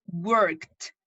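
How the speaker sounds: "Worked" said as one syllable ending in a crisp t; correct pronunciation. Shown as a demonstration. Teacher models the right "Worked" for the class. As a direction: say "Worked" like "workt", no extra vowel. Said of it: "la forma correcta de pronunciar es worked, como con una T".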